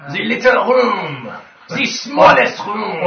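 put-on slow, drawn-out hammy French accent; mock French accent. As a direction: stretch it out in comic French. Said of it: "now you have accents for the goblins' room".

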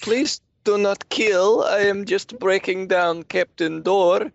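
high, slow, nervous broken English; pleading broken accent. Acted. Nikolai pleads in broken speech.

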